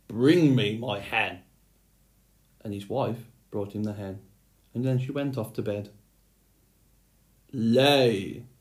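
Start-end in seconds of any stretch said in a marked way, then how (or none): 0.1-1.4 slow, loud giant command; giant's shout. Acted. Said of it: "he shouted to his wife". 7.5-8.5 a loud booming order to the hen; one loud command, like a giant; giant's shout. Acted. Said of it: "shouted the giant".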